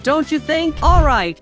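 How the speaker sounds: ordinary talk, nothing marked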